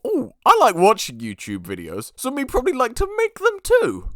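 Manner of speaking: high silly dim-witted voice; dumb kid voice. Retold or imitated. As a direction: play your clueless younger self. Mock dumb younger self.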